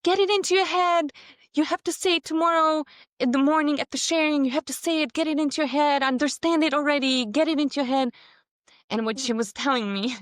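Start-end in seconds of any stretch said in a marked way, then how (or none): 0.0-8.1 plant spirit yelling. Retold or imitated. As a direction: bark it like an insistent spirit. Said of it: "the plant was yelling at me".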